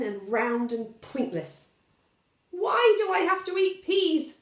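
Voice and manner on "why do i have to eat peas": put-on high, loud whining child; whiny child. Valdemar protesting to Papa about peas, high loud whine. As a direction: complain like a kid who hates vegetables. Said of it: "asks Valdemar".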